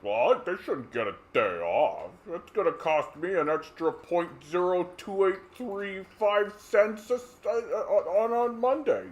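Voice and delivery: whiny stingy tycoon, climbing in pitch; stingy rich person. Retold or imitated. Wealthy miser griping about a holiday, whiny rising. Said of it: "sitting there being like".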